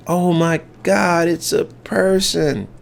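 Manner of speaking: put-on high, slow child's gasp of shock; imitating a small kid stunned by what he sees; little kid's voice. Kid spotting the fallen man, slow high shocked child voice. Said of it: "it was a little kid who said that".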